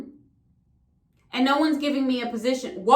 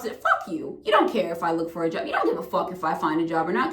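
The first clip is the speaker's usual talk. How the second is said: lower, fast dismissive snap back; low contemptuous retort; 7 semitones lower, 3 dB quieter than usual. Real, not acted. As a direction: spit it back at them low. Rant at rejecting company.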